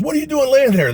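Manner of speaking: high, loud challenge; sergeant's shouted demand. Retold or imitated. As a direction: holler it at a slacking trainee. Sergeant chews out the trainee.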